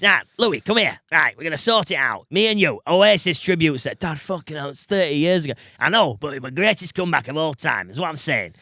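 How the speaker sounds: put-on pushy dad roping his kid into a scheme; put-on dad voice with swooping pitch; gack dad voice. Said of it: "It's my gack dad voice".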